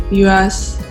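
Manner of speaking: usual speaking voice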